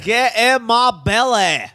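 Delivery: slow, drawn-out Scottish movie glutton; hammed-up Scottish catchphrase, stretched out; Fat Bastard. Comedian retries the impression on request, hammed-up Scottish character. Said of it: "I'm doing Fat Bastard".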